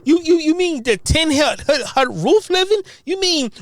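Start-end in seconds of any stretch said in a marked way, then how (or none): none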